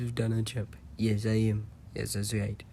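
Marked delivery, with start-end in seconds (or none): none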